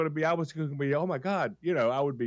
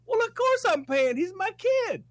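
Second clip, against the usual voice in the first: much higher, loud playful claim; mock proud parent; 3 dB louder than usual. Host imagining claiming the stranger as her kid, mock proud. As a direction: play the doting parent, all in. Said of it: "I'd have said".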